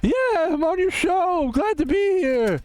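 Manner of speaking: a hammed-up squeaky celebrity guest; high fake Jerry voice. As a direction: play a goofy caller pretending to be Jerry. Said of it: "Jerry, is that you?".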